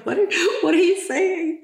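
high, laughing disbelief; imitating Max laughing. Retelling Max bursting out laughing, imitated laughing question. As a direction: imitate someone cracking up mid-question. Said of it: "Max just bursts out laughing".

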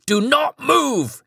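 high, slow screamed command; screamed order, drawn out. Acted. Petrified bodyguard screams at Charlie again, slow high scream. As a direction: stretch the scream out, terrified. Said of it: "he screamed".

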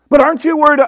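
loud, high-pitched worried objection; mock alarm. Preacher mimics a worried person challenging his good day, mock alarm. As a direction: butt in as a fretting objector. Voicing an anxious objector interrupting him.

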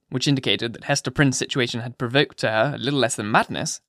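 usual speaking voice

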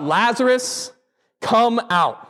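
Lazarus called high and strong; a commanding cry into the grave; calling out. Retold or imitated. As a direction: call him out of the tomb, ringing. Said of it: "shouted out, he cried out, he called out".

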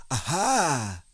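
high, swooping aha; a touch of eureka; pleased discovery. A narrator's reading. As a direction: exclaim it as the bear spots something. Pooh makes a discovery.

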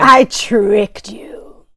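slow, whispery gloating; sly whispered tease; teasing. Real, not acted. Teasing after trick.

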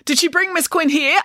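put-on high, eager boy voice; breathless boy. A narrator's reading. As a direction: ask it as the breathless son. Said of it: "He was breathless".

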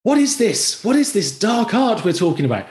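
put-on a kid discovering forbidden words; awed little daughters. Dad imitating daughters amazed by swear words. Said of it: "they're there on the bed going".

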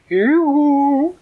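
very high, loud cooing call; goose voice. Acted. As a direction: sing it out like a silly goose. Goose arrives with pizza.

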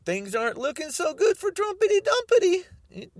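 high mocking taunt; mock pity for Trumpity Dumpity; taunting singsong. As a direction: sing the insult out to tease.

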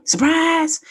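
high sing-out announcement; surprise greeting. Shown as a demonstration. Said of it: "that's how it's gonna happen".

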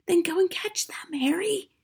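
high, whispery hiss; pressing stage whisper; urgent hiss. A narrator's reading. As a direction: hiss an order at your husband. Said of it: "hissed the mother".

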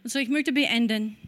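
usual speaking voice

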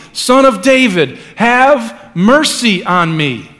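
high, loud, pleading cry. A narrator's reading. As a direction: shout it over the crowd, drawing out mercy. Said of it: "cried out all the more loudly".

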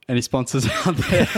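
laughing through the words; a cheeky plea that dissolves into laughter. Real, not acted. Joking plea for sponsors.

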